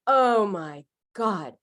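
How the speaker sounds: appalled thought. Retold or imitated. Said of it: "And I just thought".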